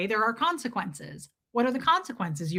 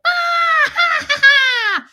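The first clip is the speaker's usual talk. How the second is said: high, loud imitation shriek; mock rabid screech; screaming; 9 dB louder, much slower than usual. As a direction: imitate a kid's long, stuttering scream. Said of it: "he was, like, screaming".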